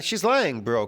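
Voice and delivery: ordinary delivery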